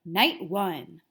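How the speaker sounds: plain reading voice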